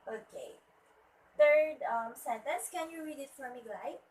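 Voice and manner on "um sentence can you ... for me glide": usual speaking voice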